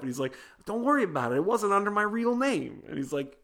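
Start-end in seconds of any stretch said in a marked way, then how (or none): 0.6-2.8 much higher, casual reassurance; breezy unconcerned. Retold or imitated. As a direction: wave it off like it's nothing. Breezy mobster reassuring handler.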